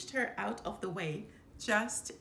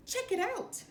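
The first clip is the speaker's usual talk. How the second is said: high thrilled cub cry; excited exclaim; 13 semitones higher, 3 dB louder than usual. A narrator's reading. Cub Nala to Simba at the water, high and thrilled. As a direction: show off the splash with delight. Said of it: "Nala exclaimed".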